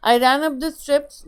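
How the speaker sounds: excited call. Shown as a demonstration. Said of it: "दौड़ा अपनी सीढ़ियों पे और चिल्लाया". (ऊँची और तेज़ आवाज़ में)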